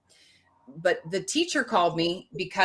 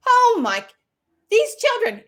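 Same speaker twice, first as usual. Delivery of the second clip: high, loud exclamation of dismay; exclaimed dismay; 8 dB louder than usual. Real, not acted. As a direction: throw up your hands vocally. Exasperated memory.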